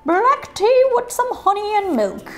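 1 put-on voice. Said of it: "I'm using that voice".

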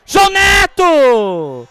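shouted scorer's name; explosive goal commentary. Real, not acted. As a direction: yell the name out in triumph. Second free-kick goal celebrated.

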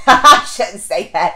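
slow, laughing take-back. Real, not acted. Fan laughing off her own bias for Arjun, laughing. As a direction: laugh as you walk it back.

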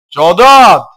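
high, loud call of a name; loud cry out. Real, not acted. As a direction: call out sharply in alarm. Shouting a name in alarm. (yüksek sesle haykırma)

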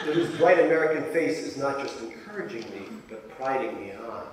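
usual speaking voice

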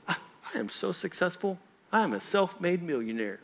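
lightly mocking a proud self-made man; a hint of a smug braggart showing off; boastful brag. Preacher mocking a prideful worker, mild smug brag.

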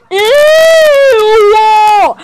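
loud high Ew!; a child's mock scream of disgust; disgusted shriek. Someone touched the poop.

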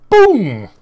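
sudden high, very loud boom; excited outburst. Real, not acted. Breaker who promised to stay calm, excited outburst. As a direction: explode with excitement on one word. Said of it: "Sorry, did I get excited?".

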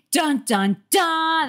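spoken suspense sting, rising in pitch; mock-dramatic "dun, dun, DUN". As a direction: sound out a cliffhanger sting. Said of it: "That feels like our first proper dun".

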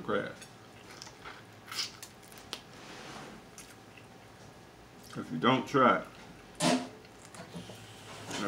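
talking with hand over mouth; eating while speaking; mouth full, chewing. Real, not acted. Seen 1: Grey-shirt speaker chews crab, hand covering mouth until 3.0s.

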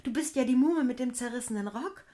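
high and loud, amazed; can't believe her eyes; excited recognition; glad surprise. A narrator's reading. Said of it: "rief Erdwürmchen froh erschrocken".

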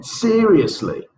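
Seriously, SE pitched high, said slowly; stress on SE. Shown as a demonstration. Teacher drilling Seriously, first syllable high and slow. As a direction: lean up hard on SE in Seriously. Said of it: "Where's the syllable stress?".

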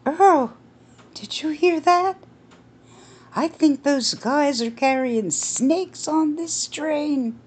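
shrill-free high fearful wife voice; alarmed Edith. Acted. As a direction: gasp it to her husband in alarm.

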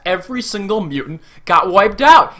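usual speaking voice

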